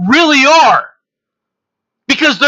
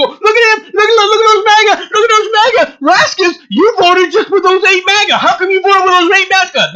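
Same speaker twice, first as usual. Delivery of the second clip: high mock-tearful whine; mocking whiny Democrats; 9 semitones higher than usual. Democrat mocked as whining over the MAGA vote, mock-tearful and high. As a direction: whine it like a crybaby caught out. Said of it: "and then they'll go".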